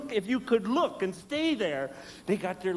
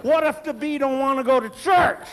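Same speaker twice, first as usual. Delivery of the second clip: panicky outburst; 7 semitones higher, 7 dB louder than usual. Retold or imitated. Panicked about the bee.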